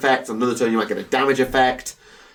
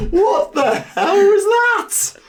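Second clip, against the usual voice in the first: loud, high, drawn-out amused outburst; laughing disbelief; 5 dB louder, much slower than usual. Real, not acted. Reacting to co-host's sudden accent, high laughing disbelief. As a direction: cry out in amused bafflement.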